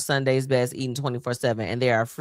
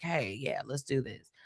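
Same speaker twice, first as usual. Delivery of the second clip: mock quiet aside; 2 semitones lower, 10 dB quieter than usual.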